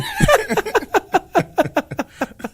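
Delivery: loud, very high burst of laughter; high shrieking laugh. Real, not acted. Laughing hard at his own burnt-jersey joke, high loud laugh.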